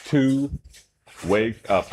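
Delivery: normal talking voice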